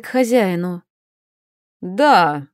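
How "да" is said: displeased grumble. A narrator's reading. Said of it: "недовольно буркнул Элайджа Брамс".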